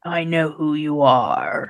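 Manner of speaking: slow, drawn-out mock villain line; audiobook Cain voice. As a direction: imitate the narrator's hammy character voice. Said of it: "she would change her voice for him".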